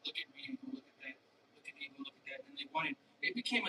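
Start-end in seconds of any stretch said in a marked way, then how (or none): 0.1-1.2 hushed, quick whispered taunt; fast breathy mock whisper; mocking gawker. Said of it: "people would come in and look and go". 1.5-2.4 barely audible mocking echo; quiet mock taunt. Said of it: "people would come in and look and go".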